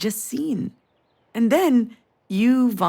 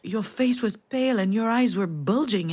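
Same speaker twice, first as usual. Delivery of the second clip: normal talking voice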